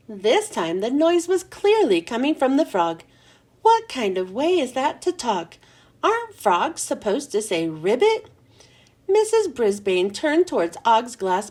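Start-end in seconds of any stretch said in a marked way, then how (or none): none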